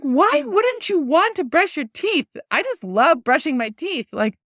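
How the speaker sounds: high, loud disbelieving question; exasperated mom. Retold or imitated. Mom scolding son who skipped brushing, high and loud. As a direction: throw it at him in mock outrage. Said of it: "I'm like".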